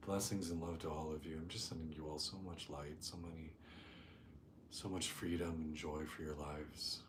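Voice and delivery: soft, hushed meditation voice with long pauses; guided meditation. Real, not acted. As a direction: settle the group gently, speaking softly and unhurried. Leads a meditation.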